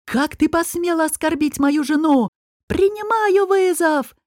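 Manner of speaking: high-pitched indignant cry, stretched at the end; outraged challenge. A narrator's reading. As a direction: build upward into a ringing challenge. Enraged husband accepts a duel. (возмущённый вызов на высоких тонах)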